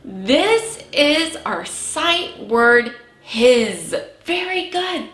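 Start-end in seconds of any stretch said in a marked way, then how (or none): 0.0-3.9 very slow, drawn out for the class; slow choral reading. Shown as a demonstration. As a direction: read it slowly with the kids. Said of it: "Can you say that with me".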